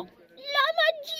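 very high, long-drawn howl; piercingly high, slow, stretched child's cry; excited child howl. A narrator's reading. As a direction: howl it out like a thrilled little girl. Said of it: "strinse i pugni ed ululò".

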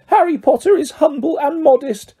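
high, worshipful elf voice; Dobby, reverent. A narrator's reading. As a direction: breathe awe into a high creature voice. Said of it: "said Dobby reverently".

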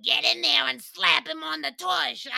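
mom's real voice. Retold or imitated. Voicing his mom cheering him on, brash and loud. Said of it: "sounds a little more like".

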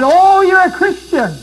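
high, loud taunting voice; high mock-scornful accusation; mocking accuser. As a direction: jeer like a scornful bystander. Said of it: "And people have said".

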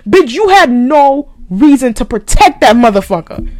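loud, furious scolding; real angry outburst. Real, not acted. Ranting at Megan for protecting her attacker, loud anger. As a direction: let rip with heavy, punched words.

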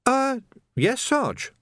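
put-on an eager young constable; youthful lad's voice; young Sam. A narrator's reading. Young recruit answers.

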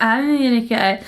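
put-on slurred drunk woman's rant; wasted slur. Wasted actress burning professional bridges, drunken slur. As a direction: slur and drag the words as if wasted. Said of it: "She's fucking wasted".

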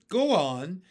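high, loud burst of urging; frantic cry. A narrator's reading. Creatures pressing James, mildly frantic cry. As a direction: push him on in a brief cry. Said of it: "they cried frantically".

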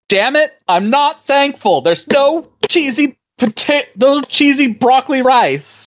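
very high, imitated angry mom; hammed-up high-pitched mom rant; mock outrage. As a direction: play an exasperated mother throwing a fit. Voices mom storming up in anger.

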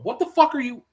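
high-pitched, bewildered retort; reenacted indignant surprise; retold startled challenge. Retold turn to confront vanished man.

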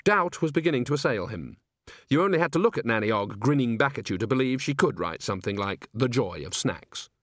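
ordinary delivery, nothing marked

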